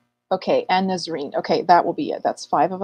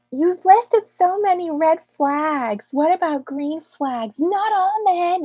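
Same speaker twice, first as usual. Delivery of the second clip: high, slow mocking voice; sarcastic high slow parody; mocking viewer quote; 9 semitones higher, 4 dB louder, slower than usual. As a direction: imitate the viewer in a high, drawn-out sneer. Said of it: "someone said".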